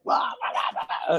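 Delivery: whispered blah-blah mimicry of sulking; quiet mocking imitation of a grumbling wrestler. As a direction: mouth off under the breath like a sulky kid. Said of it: "And he's like".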